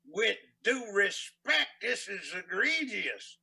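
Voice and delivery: loud, slow, drawn-out mock-formal indignation; nasty courtroom sparring. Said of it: "the judge and the lawyer were getting nasty".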